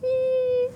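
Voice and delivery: high happy squeal; excited squeal. Real, not acted. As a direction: squeal with delight. Squeal greeting to viewer.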